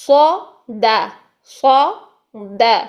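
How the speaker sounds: صا heavy and full, دا light, said slowly; slow, syllable by syllable: thick صا, thin دا; heavy and light letters. Shown as a demonstration. Teacher drills pupils on heavy صا and light دا. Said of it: "موٹے حروف کی sound".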